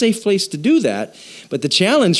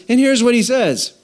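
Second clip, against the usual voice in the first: ordinary talk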